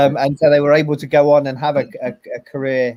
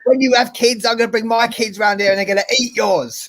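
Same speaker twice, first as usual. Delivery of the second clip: higher, mock threatening film line; quoting the character; 7 semitones higher than usual. Retelling the film's last scene, lightly voicing the husband's threat. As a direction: relish a lightly voiced bitter threat. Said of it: "But he says".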